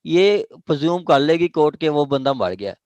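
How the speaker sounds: ordinary talk, nothing marked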